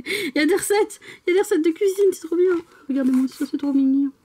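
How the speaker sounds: high, laughing, gushing excitement; excited gushing. Real, not acted. Vlogger discovering recipes in a manga, high excited gushing. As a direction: squeal with joy over the find.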